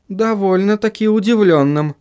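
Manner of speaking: high-pitched female character voice; measured, high-voiced agreement; agreeing woman character. A narrator's reading. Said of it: "согласилась Сахариса".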